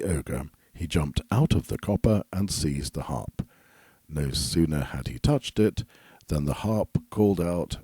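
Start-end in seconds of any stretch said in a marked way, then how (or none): none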